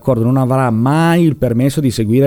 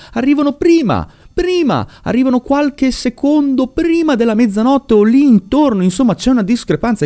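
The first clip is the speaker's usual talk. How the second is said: emphatic disbelief; 13 semitones higher than usual. Real, not acted. Narrator stressing to viewers the ambulance came first, high and insistent. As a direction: pitch jumps much higher on prima, prima, insisting.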